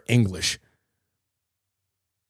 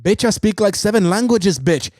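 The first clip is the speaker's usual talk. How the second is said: high, punchy mock comeback; 7 semitones higher than usual.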